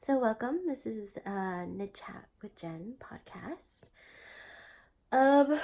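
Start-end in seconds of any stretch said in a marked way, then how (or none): 1.2-3.9 whispering. Real, not acted.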